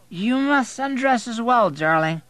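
put-on high, loud, slow drunk; drawn-out drunken come-on; incoherent drunk. A narrator's reading. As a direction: slur it out amorously. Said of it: "he's nearly incoherent".

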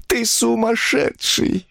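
high, drawn-out reproach; woman wailing at her husband; lamenting. A narrator's reading. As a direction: lament his madness in a plaintive voice. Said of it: "он слушал её причитания".

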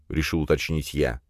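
ordinary delivery, nothing marked

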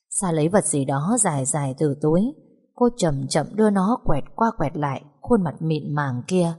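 ordinary delivery

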